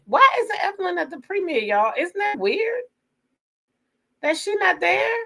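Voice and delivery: high, incredulous why-isn't-she-there; baffled surprise. Real, not acted. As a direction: pitch jumps way up in disbelief. Baffled that Evelyn skipped premiere.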